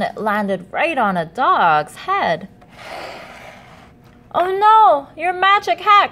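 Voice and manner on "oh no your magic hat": high, loud oh no; high loud alarmed cry; dismayed cry. Acted. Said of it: "cried the cats".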